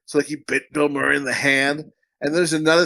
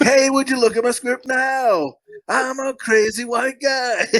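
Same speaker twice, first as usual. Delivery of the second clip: slow, much higher mock-crazy pitch; crazy fan voice; 8 semitones higher, slower than usual. Pushing a script on a celebrity, hammed-up crazy voice. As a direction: ham up a desperate oddball. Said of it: "I'm like".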